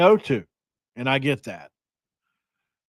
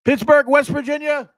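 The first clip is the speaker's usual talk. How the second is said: loud, much higher hammed-up call; high excited holler; 14 semitones higher, 6 dB louder than usual. Host announcing Pittsburgh West Virginia, high showy holler.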